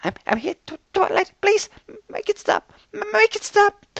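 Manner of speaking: high, shaky pleading; frightened stammer. A narrator's reading. Fluttershy begs Twilight to make it stop, frightened. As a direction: beg through fear. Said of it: "almost silent sobbing sound".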